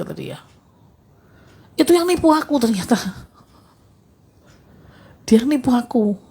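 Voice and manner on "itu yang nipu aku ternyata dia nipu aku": higher-pitched, imitating a cheated person's complaint; retold dismay at betrayal. Cheated caller telling the speaker who tricked them, retold dismay. Retelling friend's shock at betrayal.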